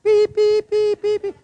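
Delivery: very high piped beeps; mock car horn. Preacher mocking the car horn interrupting, high beeping. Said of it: "car horn out here".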